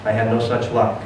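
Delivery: plain reading voice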